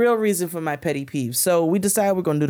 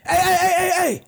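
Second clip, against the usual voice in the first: high, louder mock yell of a guard; imitated guard yelling; 14 semitones higher, 3 dB louder, faster than usual. Security guard yelling at her in skates, high mock yell. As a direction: bark out hey like a guard. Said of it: "Security guard starts yelling at me".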